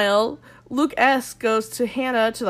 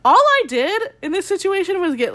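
higher and louder mock indignation; annoyed outburst; 8 semitones higher, 4 dB louder than usual. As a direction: blurt it like a wronged guy. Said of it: "annoyed 'cause he's like".

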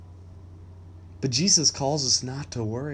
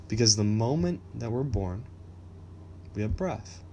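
ordinary delivery

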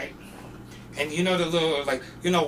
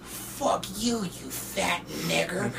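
softer, slow venomous imitation; hostile stranger; 8 dB quieter, much slower than usual. Bum insulting the worker at the door, slow hostility. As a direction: act out a slow, quiet insult.

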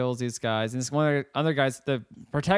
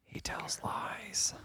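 playful stage whisper; 9 semitones lower, 15 dB quieter than usual. Real, not acted. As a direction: whisper it behind his back. Joking aside about another host.

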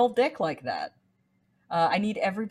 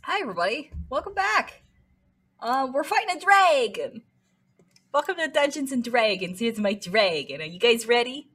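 bright host greeting; 7 semitones higher, 3 dB louder, faster than usual. Acted.

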